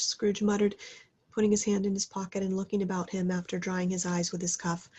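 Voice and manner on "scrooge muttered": plain reading voice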